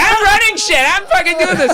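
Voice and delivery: high, bossy soccer mom; mocking the organizer lady who took the mic. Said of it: "she was like this soccer mom".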